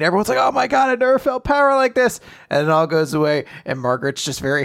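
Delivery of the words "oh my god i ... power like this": high, breathless-crowd outburst, acted; overwhelmed cry, higher than usual; awed mock scream. Host voicing everyone at an energy explosion, high mock-awed cry. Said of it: "huge explosion of energy and screaming".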